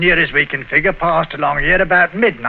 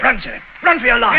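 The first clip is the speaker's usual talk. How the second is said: urgent warning; 7 semitones higher than usual. Acted. Train coming, urging escape.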